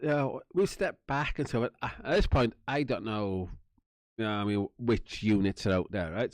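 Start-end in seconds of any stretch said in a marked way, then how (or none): 0.0-1.7 higher mock agreeing cops; lightly voiced cops agreeing fast; eager officers.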